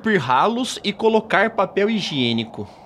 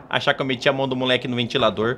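lower, fast, indignant mother repeating "meti a mão do moleque no ventilador"; mãe indignada; 6 semitones lower, 3 dB quieter, faster than usual. Acted. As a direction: rattle off "achar que eu meti a mão do moleque no ventilador" as the offended mother, low and quick. Mãe indignada repete acusação. (mãe ofendida diz rápido e grave "achar que eu meti a mão do moleque no ventilador")

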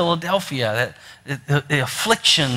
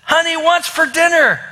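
high, exasperated yell in a comic story; hammed-up call, pitched right up; raised-voice calling; 12 semitones higher, 3 dB louder than usual. Husband right on top of his wife, calling loudly.

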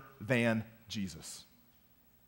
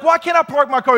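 loud retold yell; arguing loudly with the neighbor; yelling back; 12 semitones higher, 12 dB louder than usual. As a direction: shout it back at him. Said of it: "we started just kinda yelling back and forth".

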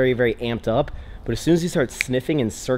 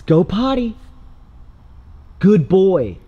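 dog praise voice; 7 semitones higher, 7 dB louder, slower than usual. Real, not acted. Urging puppy as it goes.

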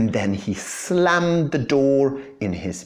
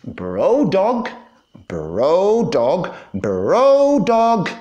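calling out louder and higher each time; yelling; 7 semitones higher, 5 dB louder, much slower than usual. A narrator's reading. Cat yells for his friend outside the door, rising shout. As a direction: yell the name toward the party. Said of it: "he yelled".

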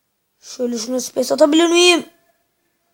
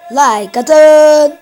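loud, higher yelled "Like atın"; shouted plea; 4 semitones higher, 9 dB louder than usual. Pretend. Vlogger yelling at his viewers to hit like, loud shout. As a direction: belt it out at the viewers.

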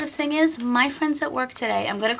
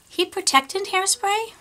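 higher, puzzled repeat of the name; incredulous question; 8 semitones higher than usual. Real, not acted. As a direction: question the product name in disbelief.

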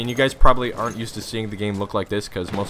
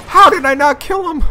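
very high, loud cry of disbelief; incredulous yell; 10 dB louder than usual. Real, not acted. Player who missed the kill, incredulous high yell. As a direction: shriek it in disbelief.

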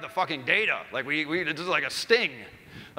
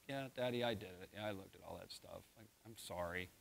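much softer, small sorry voice; quiet confession; 8 semitones lower, 18 dB quieter than usual. Retold or imitated. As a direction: barely voice the apology. Son quietly confessing to dad.

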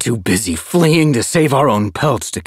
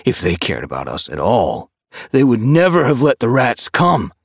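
higher, louder scornful doubt; disgusted tom; 7 semitones higher, 5 dB louder than usual. A narrator's reading. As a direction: sneer at the idea of StarClan. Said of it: "His whiskers twitched with disgust".

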